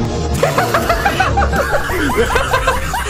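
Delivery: loud high forced laugh on cue. Pretend. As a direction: laugh hard on the countdown. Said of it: "we are gonna be laughing together in sync".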